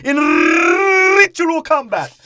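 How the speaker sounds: high, loud, very slow mock solemnity. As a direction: drag out the phrase with hammed-up gravity. Mock solemn general quote.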